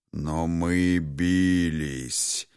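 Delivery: very slow, brooding dragon voice; дракон в воспоминаниях. A narrator's reading. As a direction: stretch the words as if lost in ancient memory. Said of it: "погрузился в воспоминания о временах". (медленно, голосом погружённого в прошлое дракона)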